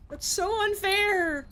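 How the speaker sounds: high, drawn-out wail of protest; whiny, frustrated outcry; mad, indignant. Real, not acted. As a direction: wail it slowly at the injustice. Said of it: "I'm so mad".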